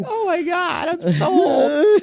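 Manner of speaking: high whiny wail; mock old viewer. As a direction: wail it like a shocked old fan. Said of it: "They're probably like".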